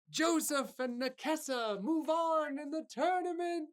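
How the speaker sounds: high, loud arena announcer; booming herald over cheering crowd; announcer call. Acted. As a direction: proclaim it to the whole crowd. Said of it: "they call out".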